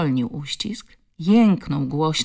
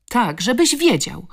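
high, loud retort; defiant shout; 6 semitones higher, 5 dB louder than usual. A narrator's reading. Girl answering her tormentor, defiant shout. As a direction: throw it back at him, loudly. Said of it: "krzyknęła z całych sił".

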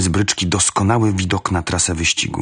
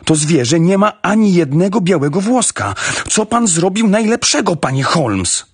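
high-pitched, outraged exclamation; agitated protest; 9 semitones higher than usual. A narrator's reading. Ross protesting to Holmes after crying out. (wzburzony wyrzut, wysokim głosem)

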